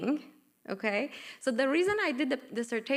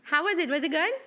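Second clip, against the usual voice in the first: ordinary talk, nothing marked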